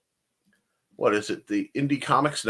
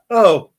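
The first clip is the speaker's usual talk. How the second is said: laugh into cough; 6 semitones higher, 12 dB louder than usual. Real, not acted.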